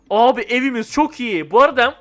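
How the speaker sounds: ordinary talk, nothing marked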